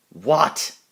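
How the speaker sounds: sudden loud high What; jolted awake and shouting; startled shout. Acted. Donald woken, startled outburst.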